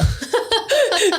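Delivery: bright high burst of laughter. Real, not acted. Host laughing at her welcome joke, high laugh.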